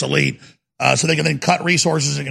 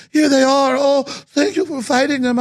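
put-on a much higher, clumsy German accent; bad German accent; 9 semitones higher than usual. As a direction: put on a poor German accent as a grateful waitress. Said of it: "I'm not doing a good German accent".

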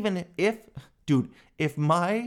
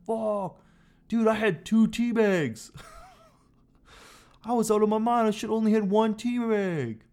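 a hammed-up, hungover confession, pitched up high; mock hungover regret; 8 semitones higher than usual. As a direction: play the guy who overdid it on tea. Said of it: "And you're like".

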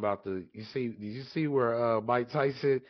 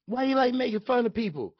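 Mike Tyson impression; 11 semitones higher than usual. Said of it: "acting Mike Tyson quote".

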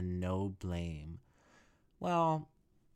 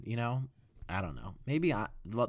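normal talking voice

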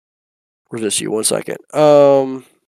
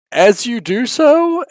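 ordinary delivery

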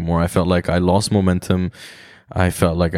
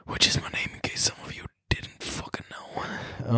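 whispered aside; 11 dB quieter than usual. Real, not acted. Whispered aside about his name.

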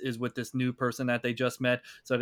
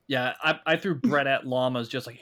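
usual speaking voice

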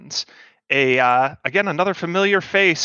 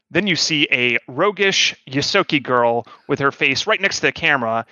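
normal talking voice

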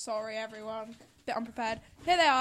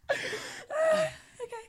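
ordinary talk, nothing marked

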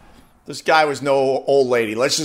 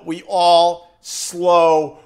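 drawn out slow; 4 dB louder, much slower than usual. Shown as a demonstration. Showing how he slows way down, words drawn out long. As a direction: stretch out slow way down, dragging down. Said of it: "showing slowing way down".

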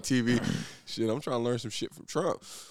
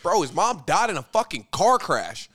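mock outburst; 6 semitones higher, 5 dB louder than usual. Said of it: "I'd be like".

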